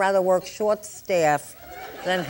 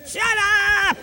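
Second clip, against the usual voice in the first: high-pitched comic shriek; hammed-up exasperation; 11 semitones higher than usual. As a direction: blow up at the endless questions in a high pitch. Snaps after nagging string of questions.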